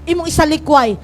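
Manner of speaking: normal talking voice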